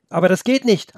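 put-on boy objecting hotly; upset protest. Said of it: "antwortete Felix aufgebracht".

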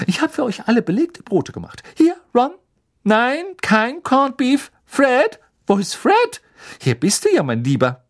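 high, loud, bustling mother voice; fussing mother. Acted. Mother handing out sandwiches to the children, high fussing voice. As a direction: call out shrilly while fussing over the children.

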